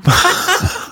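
high-pitched burst of laughter; laughing. Real, not acted. Historian cracks up after the mangled English quote, high amused laugh.

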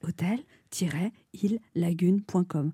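slow breathy whisper; sensual whisper. Acted. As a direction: whisper the web address seductively. Sensual ad read, website.

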